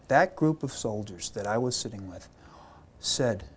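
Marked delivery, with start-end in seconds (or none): none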